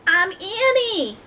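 very high, loud, stretched greeting; yelling hello. A narrator's reading. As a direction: call out slowly as Annie to a far-off creature. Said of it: "She yelled at the octopus".

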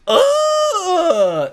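high sudden yelp of shock; startled cry. Real, not acted. Seeing the demon on the page, startled yelp.

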